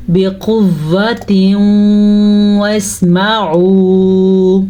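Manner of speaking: slow melodic tajweed recitation; Quran recitation. Shown as a demonstration. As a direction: draw the vowels out like a Quran reciter. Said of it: "teacher recites the verse to teach".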